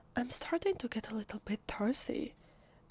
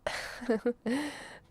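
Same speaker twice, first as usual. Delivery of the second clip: higher, incredulous giggle; amused giggle; 4 semitones higher than usual. Acted. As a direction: giggle at an absurd question. Amused by vampire question.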